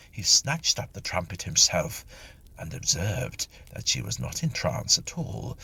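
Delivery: ordinary delivery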